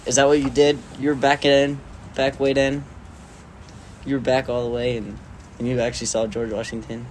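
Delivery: ordinary talk, nothing marked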